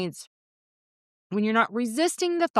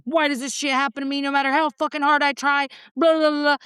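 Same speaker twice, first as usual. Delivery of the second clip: whiny victim rant; 5 semitones higher than usual. Retold or imitated. Mocking her old victimized self, a whiny rising rant. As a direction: rant like the world is against you.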